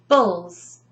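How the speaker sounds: "Bulls" alone, the last chunk of vegetables; "Bulls" as a separate, firm teaching beat; isolated syllable. Shown as a demonstration. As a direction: model just "Bulls" for repeating. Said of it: "I break it down completely".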